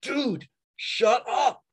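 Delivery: exasperated disciples. Retold or imitated. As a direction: roll your eyes at Peter and tell him to zip it. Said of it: "the other disciples in the room being like".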